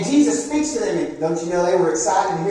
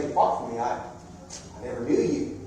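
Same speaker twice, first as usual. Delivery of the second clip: quiet stern Jesus; 8 semitones lower, 7 dB quieter than usual. Acted. Preacher voicing Jesus rejecting the unfaithful, hushed. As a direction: say it softly, like a final judgement. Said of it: "Jesus Christ would say".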